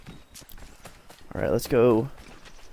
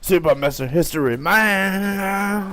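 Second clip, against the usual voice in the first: slow mock hero announcer; 4 semitones higher, 4 dB louder than usual. Hero persona, drawn out.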